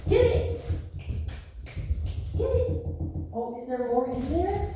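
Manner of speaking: excited dog cheer. Real, not acted. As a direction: hype the dog up to fetch. Urging dog to fetch.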